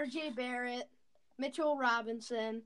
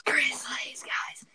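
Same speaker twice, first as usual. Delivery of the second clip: ASMR whisper; slower than usual. Pretend. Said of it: "Are we doing the A S M R?".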